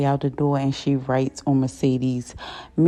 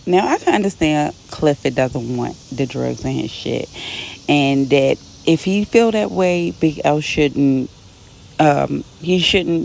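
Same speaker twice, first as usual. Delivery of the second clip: ordinary talk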